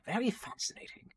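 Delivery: high, airy gnome voice; high-pitched gnome. Acted. Gnome hearing about the tree guardian, high-pitched. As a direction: murmur it high like a fascinated little gnome. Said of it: "this kind of high-pitch-ish voice".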